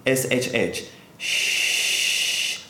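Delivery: long unvoiced shh like rain; soft shushing. Shown as a demonstration. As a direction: shush softly, like falling rain. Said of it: "the healing sound for the liver would be".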